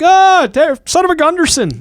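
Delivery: put-on high, loud Minnesota accent; Frances McDormand Fargo accent. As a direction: exclaim it in an exaggerated Fargo-style Minnesota accent. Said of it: "Frances McDormand in, uh, fucking Fargo".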